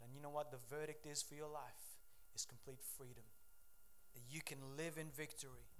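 usual speaking voice

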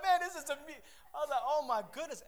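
high, loud comic alarm; mock panic. Man telling how dogs were set on him, mock panic. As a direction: retell it in an exaggerated panic.

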